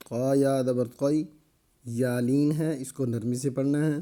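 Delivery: usual speaking voice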